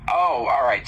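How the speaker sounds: usual speaking voice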